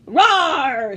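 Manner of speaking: loud put-on roar; monster roar. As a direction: bellow like a sea beast. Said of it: "said the sea monster".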